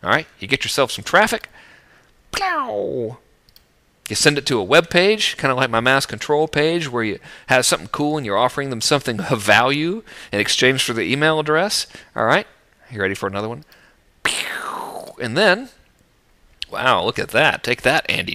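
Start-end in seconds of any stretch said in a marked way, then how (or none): 2.3-3.2 a mock explosion, plow, a little higher; sound effect. Homemade sound effect for graphic. 14.2-15.1 a breathy whispered zap, pew; tiny whispered laser noise; whispered sound effect. Acted.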